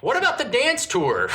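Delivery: squeaky high mocking quote; mock girly squeal asking about the tour. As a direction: pitch it way up to mock her.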